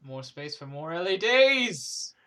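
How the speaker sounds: loud, slow, excited announcement. Acted. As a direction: sell it like a big reveal. Cheerful punchline about LEDs.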